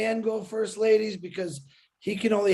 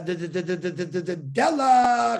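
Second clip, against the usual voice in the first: drumroll announcement. Pretend. Host calling the next caller up, drumroll announcement. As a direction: roll into the name like a game-show reveal.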